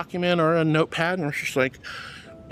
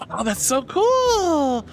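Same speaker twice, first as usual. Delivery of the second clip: high, slow, drawn-out "so cool"; delighted; 8 semitones higher, 3 dB louder, much slower than usual. Real, not acted. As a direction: savor it, delighted. Delighted reaction.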